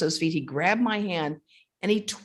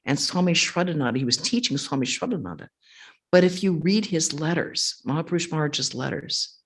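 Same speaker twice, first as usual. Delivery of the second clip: ordinary talk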